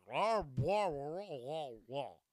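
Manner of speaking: throaty garble, loud start then falling away; over-phlegmed caricature. Shown as a demonstration. Comedian taking the questioner demo too far, phlegmy gargled caricature. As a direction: drown the words in phlegm. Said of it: "the more you phlegm it up".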